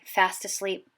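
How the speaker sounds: ordinary delivery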